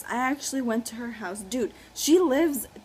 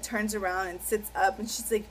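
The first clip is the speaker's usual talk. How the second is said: usual speaking voice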